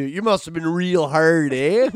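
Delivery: imitating her Canadian drawl with eh; mock Canadian. Friend mocking a Canadian woman, hammed-up Canadian accent. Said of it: "do you like how Canadian she said it".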